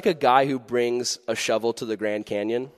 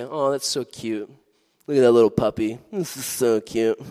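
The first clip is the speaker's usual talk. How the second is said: cooing over puppy. Retold or imitated. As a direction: gush over the puppy, mildly. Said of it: "and we say".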